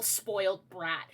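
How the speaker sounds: ordinary delivery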